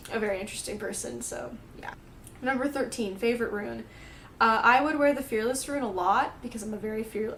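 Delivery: normal talking voice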